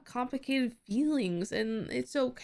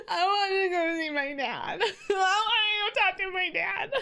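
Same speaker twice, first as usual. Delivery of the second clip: higher, laughing through tears; laugh-crying; 8 semitones higher than usual. Retold or imitated. Daddy's girl wishing to see her dad, laugh-crying. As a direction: whine it like a kid missing her dad, laughing and teary. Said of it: "I get all emotional".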